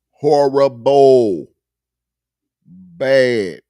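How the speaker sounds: slow, loud, drawn-out mock verdict on Horrible. Friend mocking the album with a loud slow verdict. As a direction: drag out Horrible like a judge.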